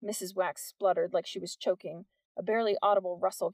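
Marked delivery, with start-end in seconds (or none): none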